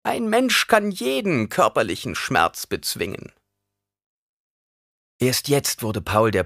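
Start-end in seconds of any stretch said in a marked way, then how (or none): 0.0-3.3 high, emphatic crone voice; scornful old woman. A narrator's reading. Old woman lectures the boy on mastering pain, high and scornful.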